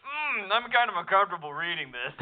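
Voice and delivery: mock squeamish reader. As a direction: play up the awkward reader. Voicing reader's reaction.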